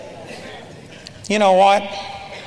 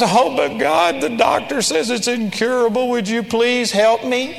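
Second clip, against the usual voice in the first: higher, pleading imitation of a believer; mock helpless plea; 4 semitones higher than usual. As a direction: beg God like someone already beaten.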